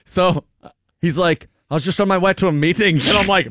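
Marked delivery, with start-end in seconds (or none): 1.7-3.0 put-on Irish accent. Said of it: "Oh, there's an accent".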